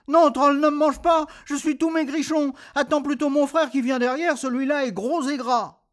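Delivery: high pleading little goat. Acted. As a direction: beg in a tiny voice. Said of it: "le petit bouc".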